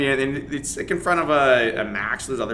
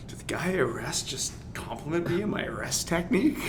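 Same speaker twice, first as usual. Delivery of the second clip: soft, unvoiced whisper; retold whisper; 4 semitones higher, 8 dB quieter than usual. Cop whispering to fellow officers about the compliment, hushed. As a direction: murmur it to the other cops in disbelief. Cops marvel among themselves.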